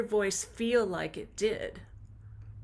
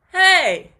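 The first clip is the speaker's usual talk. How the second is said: loud, high punch from the belly; belly-pulse shout; 6 semitones higher, 15 dB louder than usual. Shown as a demonstration. Teacher showing the belly pulse on "Hey", loud. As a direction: pulse it from the gut. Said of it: "Hey can be done with that belly pulse".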